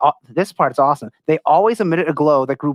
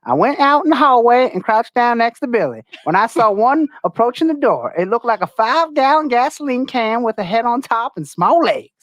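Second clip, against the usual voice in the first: quoted witness voice; 7 semitones higher than usual. Retold or imitated. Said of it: "she was quoted in saying".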